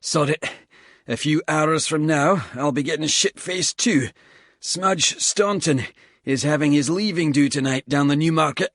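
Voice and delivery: a tired squaddie cursing the climb between breaths; lightly voiced Scottish soldier, puffing; Bald, winded Scottish soldier. Bald talking about the night.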